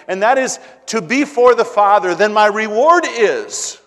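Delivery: loud, high, rising preacher's climax; preaching at full force. Real, not acted. Pastor driving the point home to his congregation, loud and rising. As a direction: build it up to a ringing peak.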